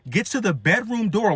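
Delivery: ordinary delivery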